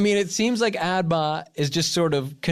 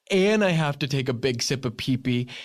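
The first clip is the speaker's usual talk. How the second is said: ordinary delivery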